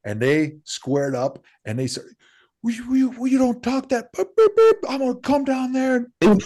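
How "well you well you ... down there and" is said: high-pitched mock tirade; sweary tirade. Big bodyguards square up to two intruders, mock tirade. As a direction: imitate an irate bodyguard, pitched way up. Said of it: "a string of F-bombs on a tirade".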